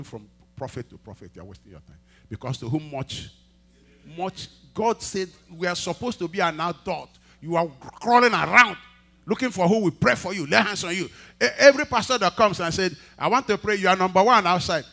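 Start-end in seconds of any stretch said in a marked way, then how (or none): 4.2-14.8 loud, high-pitched preaching. Acted. Pastor rebukes believers chasing prayers, loud preaching. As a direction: drive it home to the congregation, loud.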